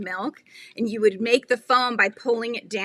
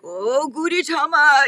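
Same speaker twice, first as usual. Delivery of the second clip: a lightly voiced singing guy, higher and slower; husband's guy voice; 6 semitones higher, 5 dB louder than usual. Dad voicing the guy's part in a family song, mock crooning. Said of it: "my husband does the guy's voice".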